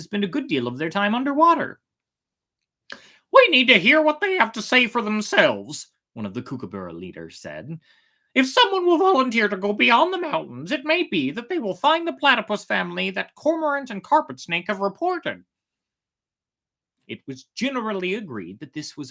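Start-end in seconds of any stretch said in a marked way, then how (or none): 3.3-5.9 higher and louder, a bird leader calling the meeting to order; kookaburra leader. Acted. Kookaburra leader urging the gathered animals, high and loud. 8.3-15.4 higher and louder, a bird leader calling the meeting to order; kookaburra leader. Acted. Kookaburra leader urging the gathered animals, high and loud.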